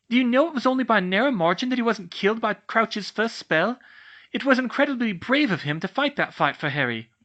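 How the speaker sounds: high, loud furious shouting; shouting at the older girl. Acted. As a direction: shout her down, outraged. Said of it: "started shouting at the older girl".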